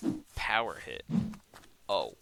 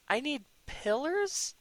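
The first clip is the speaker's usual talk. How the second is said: much higher, slow rising "I need pillars?"; incredulous; 14 semitones higher, slower than usual. Real, not acted. Builder shocked the game wants pillars, incredulous. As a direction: sound stunned at the demand.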